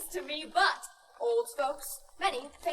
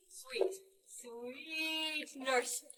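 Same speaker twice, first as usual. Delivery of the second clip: coaxing; 3 semitones lower, 7 dB quieter, much slower than usual. Acted. Juliet coaxing nurse.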